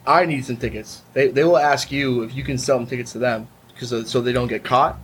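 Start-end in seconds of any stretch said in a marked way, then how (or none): none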